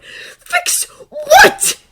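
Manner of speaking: very high, loud, tearful snap; angry snap. A narrator's reading. Draco rounding on Harry in tears, furious snap. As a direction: snap it back, close to crying. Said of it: "he snapped".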